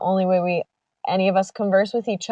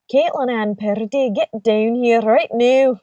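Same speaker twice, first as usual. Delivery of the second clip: imitated cross mum, louder Scottish accent; angry Scottish mother; 3 semitones higher, 4 dB louder than usual. Friend's mum mad at her, mock-angry Scottish accent. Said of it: "whenever she was mad at her".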